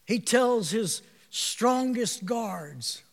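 ordinary talk, nothing marked

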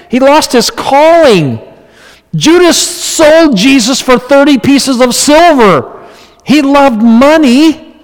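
high, urgent preacher's call; fervent preaching. Acted. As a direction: drive the point home from the pulpit. Preaches at full force.

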